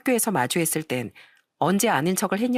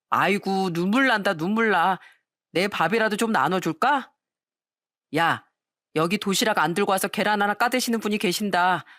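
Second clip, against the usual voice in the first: lightly acted bully's taunting; mock pity, played to an audience; mocking sneer. A narrator's reading. Bully teasing a boy over his egg lunch, mildly mocking. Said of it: "무척 비웃으면서 이런 말을 하는 겁니다".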